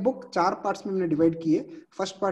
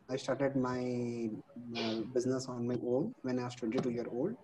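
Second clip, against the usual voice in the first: very faint, far from the mic; barely audible; 5 semitones lower, 8 dB quieter than usual. Real, not acted. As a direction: voice fading out of range. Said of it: "your voice is very low".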